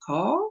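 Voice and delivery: "car" said with no R; R left silent. Shown as a demonstration. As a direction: end "car" on an open vowel, R dropped. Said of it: "do not say the R in car".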